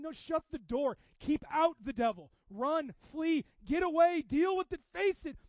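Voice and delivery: loud, high preaching shout; urgent exhortation. Real, not acted. Preacher telling congregation to shut out the devil, urgent shout. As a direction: fire off urgent commands at full force.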